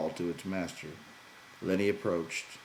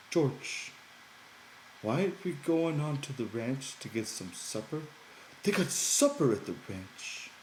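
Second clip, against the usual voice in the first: put-on higher, childlike simple man; Lenny's voice; 7 semitones higher than usual. A narrator's reading. Lenny promising George he won't talk, higher childlike voice.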